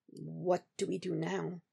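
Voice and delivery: very soft, slow, near-whispered question; hushed and halting; cracking into a whisper. A narrator's reading. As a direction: ask it slowly, barely above a whisper. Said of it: "Voice cracks into a whisper".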